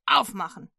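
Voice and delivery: loud barked command; shout. Acted. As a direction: bang on the door and yell it. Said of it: "Einer schreit".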